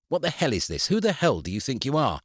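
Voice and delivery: high, heated demand down the phone; angry outburst. A narrator's reading. Eric yelling at the solicitor down the phone, outraged.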